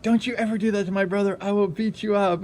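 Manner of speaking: fast, high-pitched threat in imitation; mad sister. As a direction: rattle off the threat as the angry sister. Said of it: "my sister was mad".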